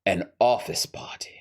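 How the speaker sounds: hushed, dramatic villain whisper; whispered reveal. Acted. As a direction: whisper the answer to your own question. Villain reveals his chosen occasion.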